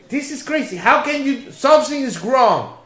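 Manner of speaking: high-pitched, loud imitation of disbelief; incredulous protest. Drunk patient insisting somebody's paying, incredulous. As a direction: mimic a flabbergasted man refusing to believe it. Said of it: "he said to me".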